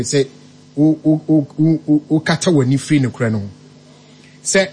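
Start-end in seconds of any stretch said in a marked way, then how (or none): none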